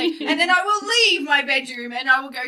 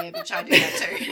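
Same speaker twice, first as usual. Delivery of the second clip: cheeky listener. Retold or imitated. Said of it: "anyone else can go".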